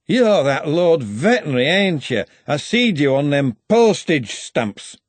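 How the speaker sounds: put-on a loud drunk footballer slurring his words; sloppy, boozy slur to the patrician; slurred drunk. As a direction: lean in, tipsy and over-familiar, slurring. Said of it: "the slurred speaker's".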